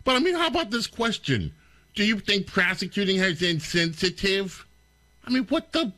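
can't believe the question; incredulous. Real, not acted. Host reacting to the reporter's question, stunned disbelief.

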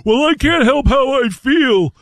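high, drawn-out mock complaint; put-on helpless whine; mocking complainer. Mocking a complaint he hates hearing.

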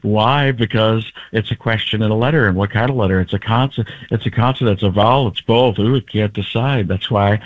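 low, musing, repeating himself; Jeff Goldblum. Acted. Comedian shows what gets him into character, musing impression. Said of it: "Like a Jeff Goldblum".

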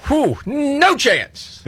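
high, very slow mocking echo; mocking disbelief. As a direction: drag it out to ridicule the prediction. Mocking the pundit's take.

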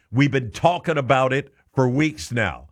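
usual speaking voice

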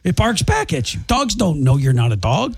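ordinary delivery

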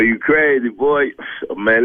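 ordinary delivery

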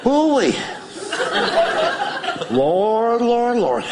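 high, breathy mock groan of a big woman; hammed-up heavy aunt sighing; big aunt imitation. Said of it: "He should go like a".

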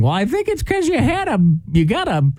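high teasing grandma voice. Retold or imitated. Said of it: "I remember my grandma saying".

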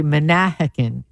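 Manahawkin with the weight on the second syllable, ma-NA-hawkin; second-syllable stress. Shown as a demonstration. Host demonstrating the local stress for Manahawkin on air. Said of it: "we put the emphasis on the second syllable".

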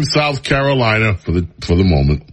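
normal talking voice

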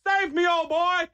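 high, slow hammed-up plea; dramatic plea. Owner sending the dog for help, high slow hammy plea. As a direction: beg the dog slowly like a movie hero. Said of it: "I'm like".

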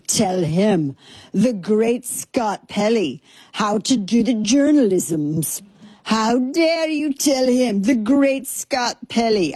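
mimicking Scott Pelley in an indignant mid-Atlantic accent; mock Atlantic accent. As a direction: lay on a faux-Atlantic newsman affectation, slowly. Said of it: "something that happens to his voice".

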